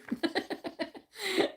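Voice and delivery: high, light giggle; real laugh. Real, not acted. Reader amused by the cards on her stream, high giggle. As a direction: laugh softly, pitched well up.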